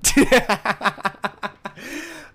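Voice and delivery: high, loud burst of laughter; giddy, delighted cackle. Real, not acted. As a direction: laugh with glee at the compliment. Laughing at own story.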